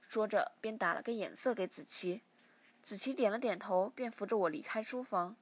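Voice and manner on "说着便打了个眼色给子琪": plain reading voice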